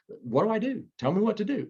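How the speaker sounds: pleading to be shown the way; eager pleading. Retold or imitated. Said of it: "And it was just".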